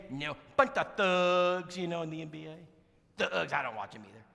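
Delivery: Perry scoffing at the NFL; disapproving. Retold or imitated. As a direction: draw the word out in disgust.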